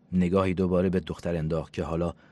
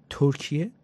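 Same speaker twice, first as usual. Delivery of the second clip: ترکیه؟ said higher with a sharp rising question; disbelieving repeat of ترکیه؟, pitch jumping up; incredulous echo; 9 semitones higher, 4 dB louder, slower than usual. Acted. Jane echoes "Turkey" in disbelief. (ترکیه؟ با ناباوری و لحن بالا رونده)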